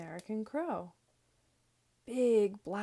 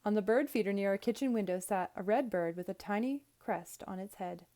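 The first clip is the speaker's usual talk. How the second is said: ordinary delivery, nothing marked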